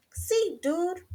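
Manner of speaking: very high little-dog voice; doggy reply. A narrator's reading. Doggies confirm it to Skipito, high-voiced reply. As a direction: answer as a tiny Chihuahua. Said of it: "replied the doggies".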